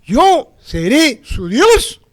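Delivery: slow emphatic restatement; slow stress. Shown as a demonstration. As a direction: spell it out word by word. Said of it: "Bien simple".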